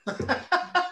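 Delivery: high, light laugh; amused laugh. Real, not acted. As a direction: laugh at the punchline. Laughter after winning first place.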